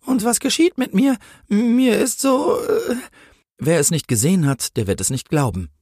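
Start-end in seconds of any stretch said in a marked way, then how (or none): 0.1-3.0 high, fearful, trailing into slowness; dazed stammering. A narrator's reading. Policewoman wonders what is happening to her, high and faltering. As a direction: falter slowly in a high frightened voice. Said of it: "stammelte sie".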